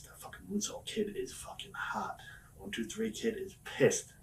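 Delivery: imitating a slow, whispery aside; hushed warning. Veteran ribbing a rookie backstage, hushed retold warning. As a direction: pass it on slowly under your breath.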